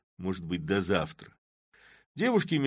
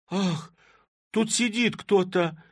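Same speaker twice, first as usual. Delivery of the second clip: high, loud scared cry; frightened cry; 12 semitones higher, 6 dB louder, slower than usual. A narrator's reading. Girls frightened by a cough in the sack, high loud cry. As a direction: shriek in fright. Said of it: "в испуге".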